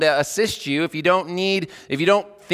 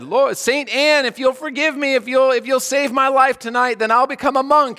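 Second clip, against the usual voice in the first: Martin Luther's desperate vow; 10 semitones higher, 4 dB louder than usual. Retold or imitated. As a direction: cry out the vow like a terrified man in a storm. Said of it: "what Martin Luther said".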